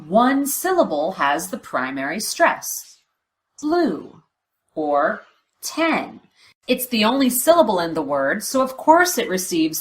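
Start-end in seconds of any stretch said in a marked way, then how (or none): 3.6-4.2 Blue said with a high pitch that falls; primary stress. Shown as a demonstration. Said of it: "Listen for that change in pitch". 5.6-6.2 ten with a high, falling pitch; primary stress. Shown as a demonstration. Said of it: "Listen for that change in pitch".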